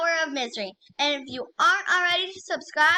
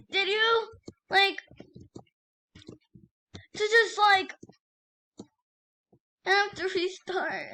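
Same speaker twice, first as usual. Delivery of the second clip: higher, teary whine; whiny near-tears; 5 semitones higher than usual. Real, not acted. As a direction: complain to viewers, on the edge of crying. Lost all progress, upset.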